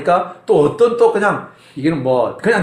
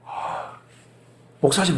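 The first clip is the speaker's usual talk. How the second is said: ordinary talk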